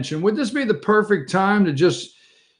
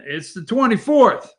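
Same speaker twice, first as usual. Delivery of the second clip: ordinary delivery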